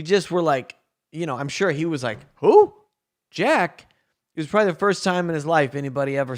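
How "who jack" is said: high, loud baffled who; mock bafflement, pitch jumping up; imagined puzzled reply, loud and high; confused who.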